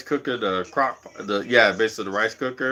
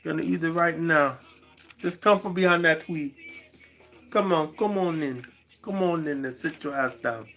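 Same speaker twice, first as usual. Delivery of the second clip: lightly voiced Jamaican parent, softer; Jamaican accent; 4 semitones higher, 6 dB quieter than usual. Strict parent calling a boy in to eat, in a light Jamaican accent. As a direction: imitate a Jamaican elder coaxing, a little raised in pitch. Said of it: "Jamaicans. They used to always be like".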